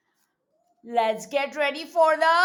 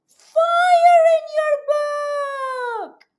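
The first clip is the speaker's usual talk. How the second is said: high, loud and slow "fire in your book"; dramatic reveal; 12 semitones higher, 6 dB louder, much slower than usual. Acted. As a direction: stretch it out with big alarm.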